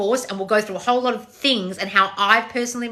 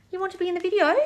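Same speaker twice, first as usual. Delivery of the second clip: cat-talk voice; 11 semitones higher, faster than usual. Real, not acted. Owner asking her cat what she wants in baby talk. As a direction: coo up high at the cat.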